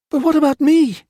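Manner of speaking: high, loud, pleading cry; desperate gasp. A narrator's reading. Abandoned wife cries after the leaving colonel, high desperate plea. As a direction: call after him in panic. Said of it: "Mema gasped".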